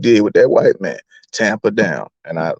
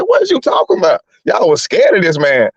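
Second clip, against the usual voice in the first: disbelieving retort; 6 semitones higher than usual. Retold or imitated. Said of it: "I said".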